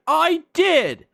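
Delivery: high, loud triumphant imitation; mock delighted reveal. Package man confirming to woman, high mock reveal. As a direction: land the surprise answer high and loud.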